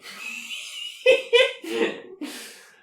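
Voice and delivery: high loud burst of laughter. Real, not acted. Laughing at his own joke to the co-host. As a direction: crack up at your own joke.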